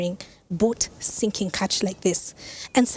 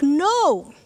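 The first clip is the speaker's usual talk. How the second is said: a short, higher called-out No across the water; shouted back; 6 semitones higher than usual. Retold or imitated. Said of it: "So they shouted back".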